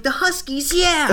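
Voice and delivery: put-on high, childish cheer; excited kid voice. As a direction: squeal like an eager kid. Kids' excited reply, imitated.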